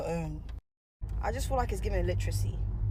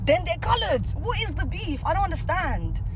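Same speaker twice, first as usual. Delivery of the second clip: pitched way up, baffled and insistent; exasperated protest; 10 semitones higher than usual. Real, not acted. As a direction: protest in high-pitched disbelief. Exasperated at critics of the term.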